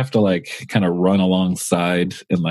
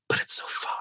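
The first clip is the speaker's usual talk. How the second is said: hushed, voiceless lament; despairing whisper; 12 dB quieter than usual. Retold or imitated. Wishing he could go home, despairing whisper. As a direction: let it fade to a longing whisper. Longing for home he can't reach.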